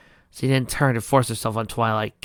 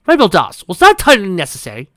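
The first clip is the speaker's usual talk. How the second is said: high, loud outraged demand; 8 semitones higher, 12 dB louder than usual. Acted. Luna confronting Rainbow after the knockout. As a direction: demand an answer sharply. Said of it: "Luna demanded".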